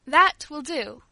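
stern. Acted. Father cuts her off.

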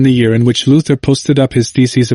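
ordinary delivery, nothing marked

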